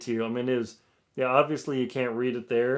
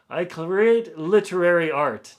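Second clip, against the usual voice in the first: slow, louder mock pompous English accent; 4 semitones higher, 8 dB louder than usual. Author reading a rude review aloud, pompous English accent. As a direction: play a snooty English literary snob. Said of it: "doing my best, uh, pompous English accent".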